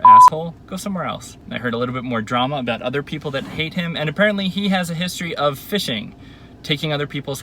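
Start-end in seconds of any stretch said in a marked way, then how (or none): 0.0-1.4 high, loud single insult; furious shout. Real, not acted. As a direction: hurl it like a slap. Telling boyfriend to stay away.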